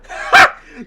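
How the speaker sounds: a shrill, loud giggle; high burst of laughter. Real, not acted. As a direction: laugh at the silly name. Laughs at mob name.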